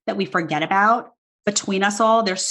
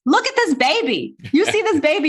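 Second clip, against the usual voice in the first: excited; 8 semitones higher than usual. Real, not acted. Showing the baby on the book cover.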